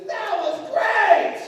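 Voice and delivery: put-on very high, drawn-out kid shout; thrilled kid yell. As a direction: yell it slowly like a bloodied, ecstatic child. Hurt kid yells in delight.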